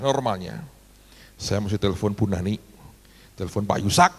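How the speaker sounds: normal talking voice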